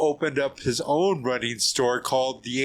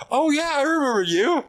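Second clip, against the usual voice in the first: imitating a delighted friend, much higher and louder; suddenly remembering, thrilled; excited recognition; 13 semitones higher, 5 dB louder than usual. Retold Paulo recognizing him.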